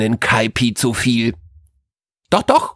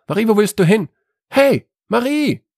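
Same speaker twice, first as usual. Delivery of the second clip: calling after her; 9 semitones higher than usual. Acted. Calling after Marie who walks away.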